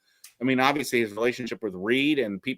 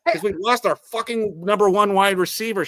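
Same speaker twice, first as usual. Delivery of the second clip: exasperated retort; 9 semitones higher, 4 dB louder than usual. Retold or imitated. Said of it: "I'm like".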